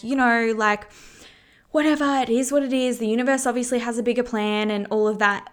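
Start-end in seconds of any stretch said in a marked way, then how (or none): 1.7-4.7 dismissive shrug-off. Retold or imitated. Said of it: "and being like".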